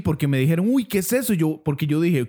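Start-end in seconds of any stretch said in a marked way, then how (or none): 0.7-1.3 scared. Retold or imitated. Quoting frightened bystander again.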